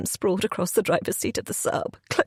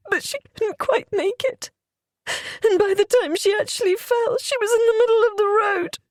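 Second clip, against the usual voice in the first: tearful narration; 12 semitones higher than usual. Real, not acted. Narrator breaks down recounting accident.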